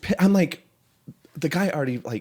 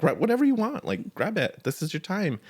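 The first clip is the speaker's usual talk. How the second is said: acted high-pitched insistence; retold eager encouragement, pitched up; insistent urging; 10 semitones higher than usual. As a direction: urge him on, voice lifted high. Urging hungry man to take food.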